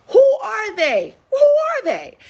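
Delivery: excited buildup. Acted. Building a question for the crowd.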